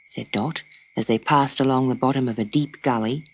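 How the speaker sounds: usual speaking voice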